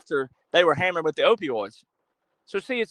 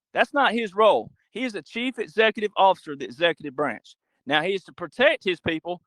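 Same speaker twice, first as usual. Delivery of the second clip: ordinary delivery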